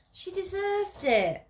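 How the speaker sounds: high, loud, slow protest; protest cry. Acted. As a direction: cry it out in self-defence. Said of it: "I cry".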